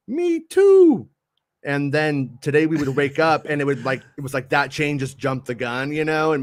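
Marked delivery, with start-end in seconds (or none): 0.1-1.1 very high squeaky reply; mock fan voice. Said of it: "people would be like".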